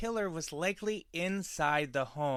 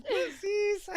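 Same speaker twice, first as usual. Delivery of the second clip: laughing squeak. Real, not acted.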